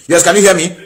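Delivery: high, loud checking call. Real, not acted. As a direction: call out to reach the caller. Calling to caller on bad line.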